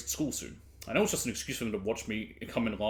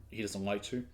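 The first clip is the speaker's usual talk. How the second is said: ordinary talk, nothing marked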